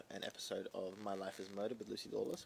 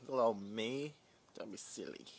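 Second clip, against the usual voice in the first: mock modesty; 5 dB louder than usual. Mock modest reply to compliment.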